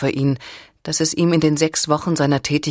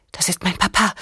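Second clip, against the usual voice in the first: whispered fear; 4 semitones higher than usual. A narrator's reading. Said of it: "flüsterte sie panisch".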